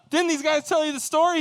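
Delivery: very high, loud mock indignation; incredulous retort. Son answering his impatient dad, high loud incredulity. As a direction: fire back at dad in disbelief.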